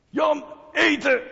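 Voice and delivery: long, high, drawn-out call home for dinner; father calling loudly. Retold or imitated. Father calls his son in from playing outside, loud drawn-out call. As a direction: bellow the name across the street. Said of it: "Zo hard riep hij".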